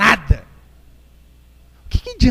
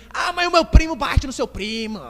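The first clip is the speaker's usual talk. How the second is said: high, drawn-out playground boast; criança provocando; 8 semitones higher than usual. Acted. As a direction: brag like a bickering kid, slow and high. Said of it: "daí tem briga lá". (criança implicante, voz aguda e arrastada)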